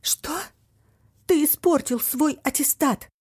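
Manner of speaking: gasped then shouted high; stunned, then yelling; shocked outburst. A narrator's reading.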